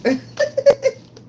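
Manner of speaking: a sudden loud, high laugh. Real, not acted. After recalling bathroom crying, loud high laugh. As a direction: laugh through the memory.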